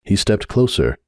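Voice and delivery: plain reading voice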